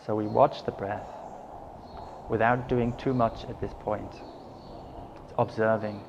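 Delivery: unhurried meditation guidance with long pauses; guided meditation. Acted. Teacher settling listeners into breath and body, calm guided meditation. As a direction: guide them gently into stillness, leaving space between phrases.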